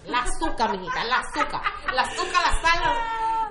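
laughing through mom's correction, rising high; mock mother voice breaking into laughter; imitating mom with a laugh. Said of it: "y mi mamá decía".

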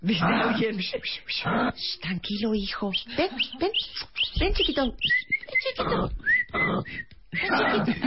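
high, coaxing call to a small creature; play-acted "here, little one"; luring an animal. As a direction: coax it over gently, like calling a pet. Acts out luring the snorting animal.

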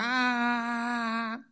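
soft hammed-up whimper; mock sob. Crying man in the joke whimpers softly. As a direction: fake a little cry. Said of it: "Y llora".

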